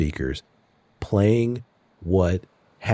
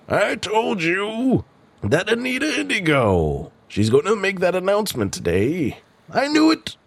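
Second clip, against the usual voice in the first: high character voice; 12 semitones higher than usual. Acted. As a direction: squeak it out, higher and higher toward the end. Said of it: "the sound of another voice".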